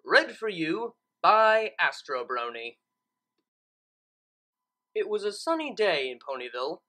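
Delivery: ordinary delivery, nothing marked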